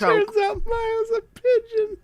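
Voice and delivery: high, slow giggling repeat; high giggling tease; laughing. Real, not acted. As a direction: squeal the joke back while laughing.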